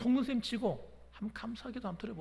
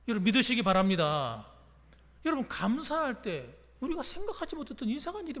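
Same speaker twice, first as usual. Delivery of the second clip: preaching exhortation; 5 semitones higher, 4 dB louder than usual. Real, not acted.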